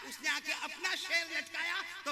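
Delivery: sermon story building to a high shout; impassioned preacher at full force; fiery preaching. Real, not acted.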